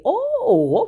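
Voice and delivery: a high, squeaky fake "oh"; mock surprise. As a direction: pretend you're caught off guard.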